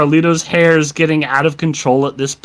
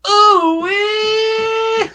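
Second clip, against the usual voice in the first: very high cheering whoop; excited whoop. Pretend. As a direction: squeal the whoop out. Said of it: "can I get an ooh-wee".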